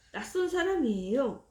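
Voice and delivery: ordinary delivery, nothing marked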